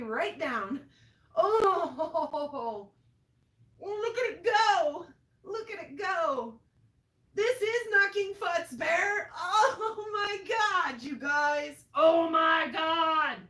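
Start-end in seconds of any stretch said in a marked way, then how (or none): 1.4-6.6 high, loud, laughing delight; giddy exclaiming at a spectacle; thrilled amazement. Real, not acted. Thrilled watching lightning go. 7.3-13.4 high, full-voiced excited shout; real overwhelmed shouting; yelling in awe. Real, not acted. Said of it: "I don't mean to yell at you".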